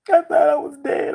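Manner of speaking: high, cracking voice close to tears; voice breaking. Real, not acted. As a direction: choke up as you say it. Reliving seizure, voice breaking.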